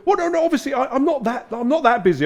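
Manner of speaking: high, loud, hurried denial; flustered backtracking. Retold or imitated. Chief execs backtracking in a meeting, high and flustered. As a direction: imitate embarrassed bosses climbing down.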